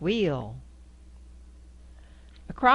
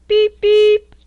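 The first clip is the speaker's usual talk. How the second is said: much higher, loud tinny beeps; high squeaky beep; 15 dB louder than usual. Acted. Reading to a child, beeping high and loud like a horn.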